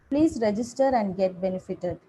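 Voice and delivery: normal talking voice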